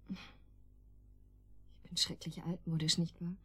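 slow, soft, almost voiceless; bashful slow whisper; whispered confession. Acted. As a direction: confide it shyly, barely aloud. Shy confession, intimate.

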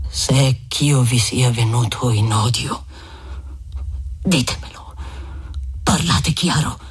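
a wounded lover insisting he won't forget; Renzo indignant. Acted.

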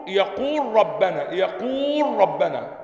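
يقول ربنا with the و of يقول stretched slowly and the لام merged into ربنا; slow drilled madd in يقول ربنا; lengthened madd. Shown as a demonstration. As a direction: hold the long و of يقول, then merge into ربنا. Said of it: "في حالة الإدغام ها، آه، تمد".

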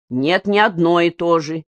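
ordinary delivery

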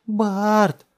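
very high, loud, held cry; desperate piercing call; calling for help. A narrator's reading. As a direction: scream a name for rescue. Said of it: "снова позвала Джессика".

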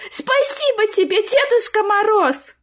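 put-on high-pitched, drawn-out child's thanks; squealing gratitude; joyful shouting. A narrator's reading. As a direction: shout your thanks as a thrilled little girl. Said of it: "закричала Сонечка".

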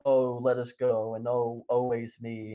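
slow, drawn-out whiny begging; mock whining plea. Captured heretics begging Casca to save them, slow mock whine. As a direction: ham up the heretics' self-pity. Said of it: "crying out to Casca".

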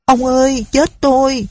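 Mến trapped in the hot jar, calling out in panic; panicked cry. Acted. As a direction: cry out half-choked, begging to be saved. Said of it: "Nó gọi ú ớ".

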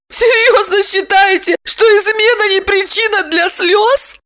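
very high, squeaky indignant question; astonished woman. A narrator's reading. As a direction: ask in squealing disbelief. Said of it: "поразилась Липочка".

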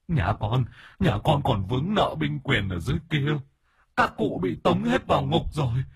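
high, tearful pleading with the master; terrified possessed woman crying out; panicked spirit. Acted. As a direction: beg for rescue in a high, wailing voice. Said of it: "không che giấu được sự hoảng hốt".

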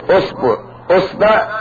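أصبع said "usbu'", then "usba'"; damma on hamza. Shown as a demonstration. Teacher showing damma forms, أصبع as "usbu', usba'". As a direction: say أصبع opening on u, changing the second vowel from u to a. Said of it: "ثم نأتي ضم الهمزة".

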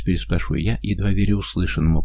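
plain reading voice

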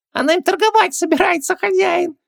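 very high tiny squeak; squeaky genie. A narrator's reading. Genie tells on her to his master, squeaking. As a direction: pipe it up like a little genie. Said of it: "пискнул Джин".

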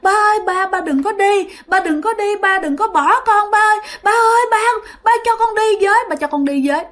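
high-pitched desperate pleading, repeated over and over; child's insistent high wail; crying, wailing child. Acted. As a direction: beg dad not to leave, like a distraught child. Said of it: "Nó cứ khóc liên tục và nó la".

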